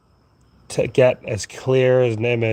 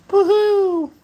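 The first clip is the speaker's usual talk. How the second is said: a very high squealed woohoo; mock cheer; 3 dB louder than usual. Vlogger cheers his own yawn, very high mock squeal. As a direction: squeal it like a cartoon fan.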